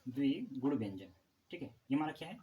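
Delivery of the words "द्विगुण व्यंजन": द्विगुण व्यंजन said slowly with weight on द्विगुण; slow drilled term. Shown as a demonstration. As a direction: spell out the term slowly for students. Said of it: "बोलते हैं द्विगुण व्यंजन". (द्विगुण व्यंजन को धीरे-धीरे, ज़ोर देकर)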